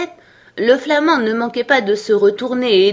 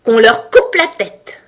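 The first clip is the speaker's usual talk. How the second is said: high, loud furious command; enraged royal shout; shouted death sentence; 5 semitones higher, 8 dB louder than usual. A narrator's reading. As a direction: scream the order like a tyrant queen. Furious queen orders an execution.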